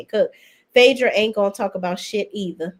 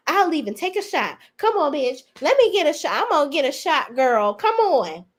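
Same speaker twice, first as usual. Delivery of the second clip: higher, pushy party-girl voice imitating Britt; hyped mock Britt; 6 semitones higher than usual. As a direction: ham up Britt begging for a shot. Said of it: "Britt was like".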